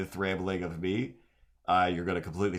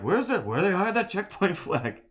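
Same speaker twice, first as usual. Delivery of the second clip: put-on high, quavering old-man search; confused old man; 11 semitones higher, 4 dB louder than usual. Streamer retells hunting the checkpoint flag, high old-man whine. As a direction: whine it like a lost grandpa. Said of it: "and I was like".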